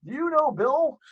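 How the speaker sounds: name-drop with put-on courtesy; fake chummy voice. Driver name-dropping to officer, fake chummy voice.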